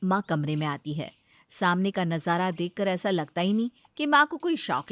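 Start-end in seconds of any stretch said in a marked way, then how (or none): none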